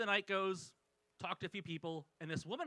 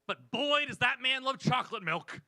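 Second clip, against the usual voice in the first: loud, high, emphatic; emphatic punchline; 5 semitones higher, 6 dB louder than usual. Acted. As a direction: punch it up big. Punchline about chocolate milk.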